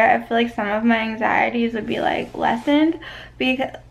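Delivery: ordinary talk, nothing marked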